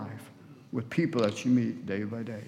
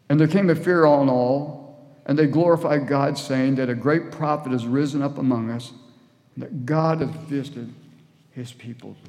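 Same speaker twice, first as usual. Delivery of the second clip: loud, emphatic verse reading; scripture proclamation; 7 dB louder than usual. A narrator's reading. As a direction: proclaim the verse to the whole congregation. Reads scripture aloud to congregation.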